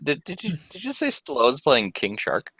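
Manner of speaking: ordinary talk, nothing marked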